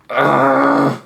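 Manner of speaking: sharp, loud cry of frustration; short exasperated shout; frustrated yell. Real, not acted. Frustrated at the puzzle.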